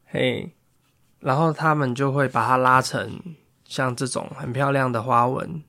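plain reading voice

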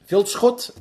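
a careful rising Vilschot; repeating the name back. Retold or imitated. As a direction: try the name out, checking it. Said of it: "I was like".

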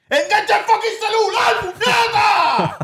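yelling out the car window at a texting driver; retold road rage yell. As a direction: bellow it at the driver on her phone.

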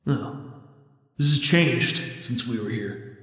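quiet, wary guide voice; hushed cautious scout, unsettled; Lizeth voice. Acted.

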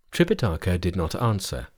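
plain reading voice

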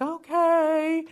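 high, drawn-out okay; hesitant agreement. Retold or imitated. Mother humoring the therapist's odd instructions, hesitant.

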